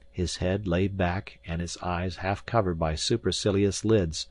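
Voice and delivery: ordinary delivery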